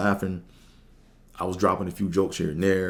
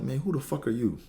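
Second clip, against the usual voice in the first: cold brush-off, slightly higher and falling on who; dismissive attitude; 7 semitones higher, 3 dB quieter than usual. Retold or imitated. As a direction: sneer it like you've never seen him. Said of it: "you get the attitude with it".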